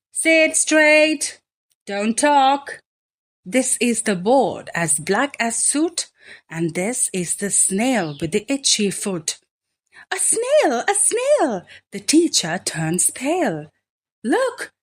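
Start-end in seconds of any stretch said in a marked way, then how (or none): 0.1-2.8 louder, sharp classroom order; stern teacher. A narrator's reading. Teacher orders the class, louder and stern. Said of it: "telling the class". 10.2-11.7 a much higher shriek of alarm; shocked teacher. Acted. Teacher shrieks at the snail on the board. 14.2-14.7 put-on much higher child's cry; children calling. A narrator's reading. Children call out to the teacher, high. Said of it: "say the children".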